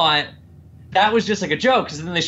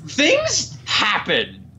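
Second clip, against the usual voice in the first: much higher, slow and drawn out; slow exasperated; 9 semitones higher, much slower than usual. Real, not acted. Complaining about the movie's plot, higher slow exasperation. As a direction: drag out "things happen" in exasperation.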